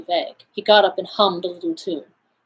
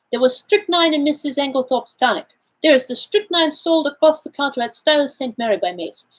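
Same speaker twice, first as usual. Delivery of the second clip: put-on higher-pitched accented detective, explaining; Poirot, self-assured; 7 semitones higher than usual. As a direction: play the Belgian detective laying out his findings. Figure of Poirot in read story.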